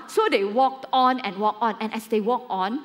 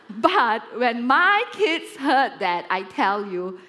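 ordinary talk

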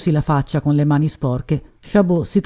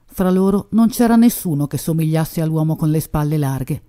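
plain reading voice

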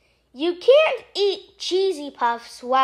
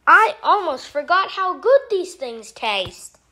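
usual speaking voice

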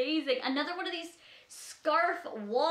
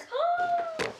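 high gasp. Real, not acted. Seeing yellow purse.

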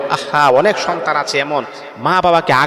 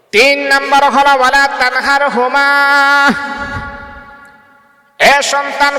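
high, ringing sermon chant; fervent waz preaching, pitched high and loud; impassioned preaching; 11 semitones higher, 5 dB louder than usual. Acted. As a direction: lift the voice and call out to the crowd. Preacher numbers Quranic commands.

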